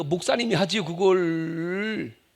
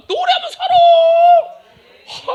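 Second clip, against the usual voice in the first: much higher, loud, slow crying shout; preacher's impassioned outcry; 10 dB louder, much slower than usual. Real, not acted. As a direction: cry it out with full force. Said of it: "그냥 노래요".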